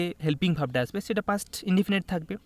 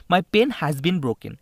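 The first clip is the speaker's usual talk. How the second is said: very slow and louder, word by word; teacher reading a test sentence to students, very slowly; slow dictation; 5 dB louder, much slower than usual. Shown as a demonstration. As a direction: read the sentence out slowly for the class.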